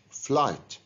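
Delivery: "flight" said as /flaɪt/ with the gh silent; "flight", no sound for the gh, a clean long i straight into t. Shown as a demonstration. Teacher models "flight" for students with the gh silent. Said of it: "we pronounce the word without pronouncing the G H".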